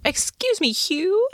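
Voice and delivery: high, tentative polite call rising at the end; lilting, questioning greeting; polite approach. Acted. Player voices Doris approaching Hugh.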